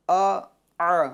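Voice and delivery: ا alternating squeezed mid-throat ayn and light hamza; ayn against hamza. Shown as a demonstration. Teacher showing the difference, alternating ayn and hamza on ا. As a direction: contrast the two sounds of ا, throaty then clean. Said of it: "دونوں کی آواز میں فرق ہے".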